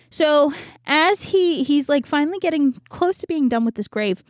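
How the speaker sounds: ordinary delivery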